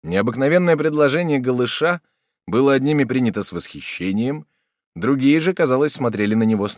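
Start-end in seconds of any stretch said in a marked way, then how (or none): none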